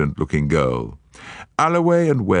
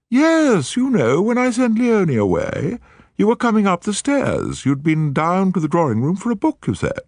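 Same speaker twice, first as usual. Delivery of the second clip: high, probing society lady's voice; lady needling Juliet about the stairs, pointed and high. Acted. Woman questioning Lady Juliet, pointed, higher voice.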